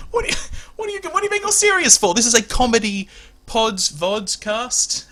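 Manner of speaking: high, loud comic protest; mock indignation. Host chides co-host for being serious on a comedy show, mock indignant. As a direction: scold them in a high, exasperated voice.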